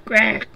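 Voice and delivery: 높고 크게 꽥 하는 돼지 울음; 돼지처럼 한 번 꽥. Acted. (높은 돼지 비명 흉내)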